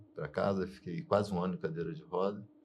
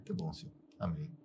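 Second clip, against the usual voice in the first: meek surrender; 4 semitones lower, 10 dB quieter than usual. Retold or imitated. Giving in to God, retold.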